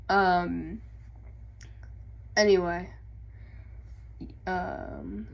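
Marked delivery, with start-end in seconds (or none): none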